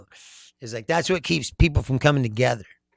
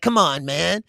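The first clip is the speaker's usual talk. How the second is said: normal talking voice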